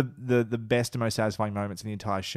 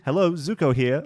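lightly imitated awkward introduction, a little higher and louder; a louder, raised hint of Zuko saying hello; Zuko's greeting; 4 semitones higher, 5 dB louder than usual. Quoting a character's practiced introduction to a co-host, light imitation. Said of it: "What's, what's his thing?".

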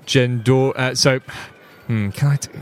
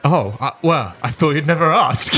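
mock eager; 6 semitones higher, 4 dB louder than usual. Mock eager reply, laughing.

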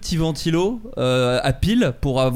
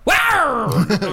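rugissement d'alarme de vélo; 10 semitones higher than usual. Retold or imitated. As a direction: imitate a bike alarm's roaring lion. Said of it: "c'est un lion qui rugit". (rugissement de lion imité, long et aigu)

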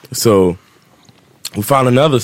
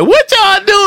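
very high, louder mock screech; imitated mom; 5 dB louder, slower than usual. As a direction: mimic a scandalized mother shrieking down the phone. Acts out mom on the phone.

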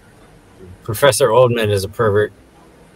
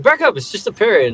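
higher brush-off; mock dismissive; 9 semitones higher than usual. Shorty brushing the parrot off, higher voice. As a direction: shove past dismissively. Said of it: "He's like".

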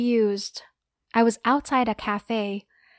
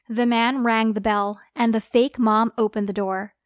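usual speaking voice

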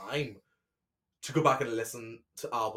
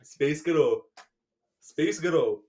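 attempted LA accent; 3 dB louder, slower than usual. Shown as a demonstration. Demonstrating a sound-alike to co-host, LA accent. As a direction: try out an LA accent, building slightly.

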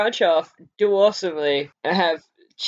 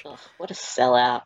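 mock disgust; 9 semitones lower than usual. Teasing disgust at co-host.

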